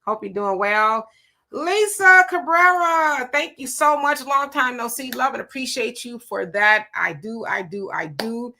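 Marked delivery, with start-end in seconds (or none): 1.5-6.9 light up for a long-missed friend; delighted greeting. Real, not acted. Delighted to see old viewer.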